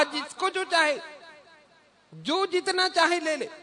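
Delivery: high-pitched announcement to the court; royal proclamation. Acted. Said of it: "ऐलान है".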